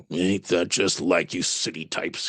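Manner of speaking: low, slow, gruff coachman voice. Acted. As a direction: spit it out low like a bitter coachman. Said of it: "he spits".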